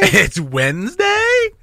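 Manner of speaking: high, slow, rising question; laughing uptalk, stretched out. Shown as a demonstration. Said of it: "when my voice goes up at the end".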